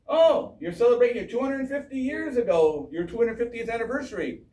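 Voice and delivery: high, lightly put-on royal surprise; King Charles. British king remarking on America's anniversary, mock impressed. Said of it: "King Charles was here a couple weeks ago".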